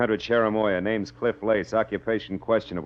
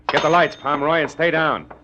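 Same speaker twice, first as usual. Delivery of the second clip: higher and louder, quick urgent orders; urgent command; 7 semitones higher, 5 dB louder than usual. Acted. Detective tells Pomeroy to kill the lights as company arrives, urgent. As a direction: snap out the orders as trouble arrives.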